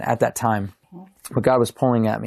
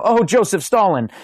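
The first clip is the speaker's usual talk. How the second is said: high-pitched pretend exclamation; mock cursing; 8 semitones higher than usual. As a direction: curse in mock pain, pitched well up. Mock swearing after stubbed toe.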